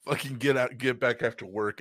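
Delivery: usual speaking voice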